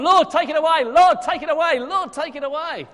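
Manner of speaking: loud, high repeated begging, fading on the last; desperate pleading. Retold or imitated. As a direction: beg over and over, loud then dropping. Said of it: "he kept on".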